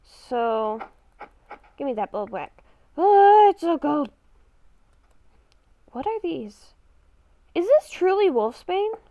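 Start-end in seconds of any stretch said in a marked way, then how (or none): none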